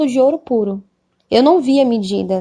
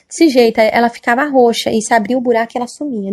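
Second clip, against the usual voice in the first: ordinary delivery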